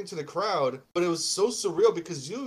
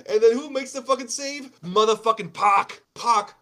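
excited shout; 7 semitones higher, 7 dB louder than usual. Real, not acted. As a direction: hype it up, louder and higher. Hyping the big save.

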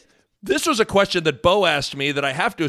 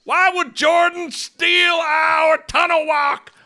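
outraged Nebraska fan; 10 semitones higher, slower than usual. Retold or imitated. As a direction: whine like an indignant local fan. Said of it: "It's like".

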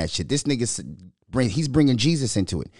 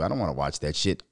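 ordinary talk, nothing marked

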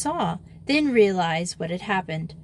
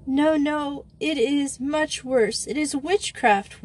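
put-on high, frightened girl's wail; distraught character voice, pitched up; 7 semitones higher than usual. A narrator's reading. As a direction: wail it in superstitious dread. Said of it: "wailed Bet".

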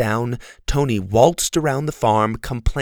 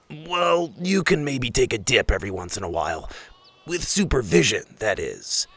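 correcting pig voice; 3 dB quieter than usual. A narrator's reading. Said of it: "he corrected".

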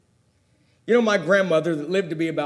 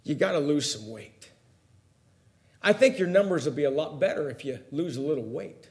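soft, low imitation of a kindly doctor; kind and gentle; 4 semitones lower, 5 dB quieter than usual. Doctor gently tells an overweight patient to lose weight. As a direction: break it gently, keeping the voice down. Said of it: "says very kindly and very nicely, and even very gently".

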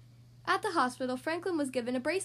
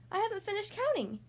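wobbly voice; 7 semitones higher than usual. A narrator's reading. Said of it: "he said in a wobbly voice".

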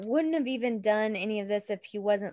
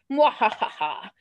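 villain laugh; slower than usual. Acted. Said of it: "Let's be villains".

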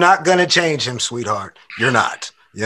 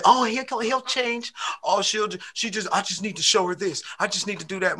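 mock self-deluding partner; 3 semitones higher, 9 dB quieter than usual. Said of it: "People keep lying to".

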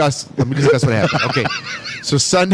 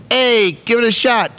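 higher, drawn-out midway pitch; carny calling out; 7 semitones higher than usual. Retold or imitated. Carny calling to a young couple, hawking. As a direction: call out slowly to passersby. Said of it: "the carny goes".

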